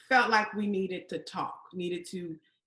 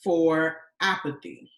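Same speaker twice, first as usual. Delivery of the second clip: slow, loud proclamation; emphatic post; 2 semitones lower, 4 dB louder, much slower than usual. Acted. Woman reads out her social media post, slow emphatic declaiming. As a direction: declaim it like a slogan, word by word. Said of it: "I posted".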